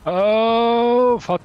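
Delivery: real rage at dying; long bellowed oh; furious shout. Real, not acted. Gamer dies, furious.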